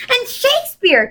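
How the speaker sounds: high eager call-out. A narrator's reading. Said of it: "Charles Wallace called out".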